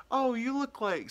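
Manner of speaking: high mimicked stranger's voice; imitated nosy stranger. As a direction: pitch it up as an eager stranger. Mimics people who insult him.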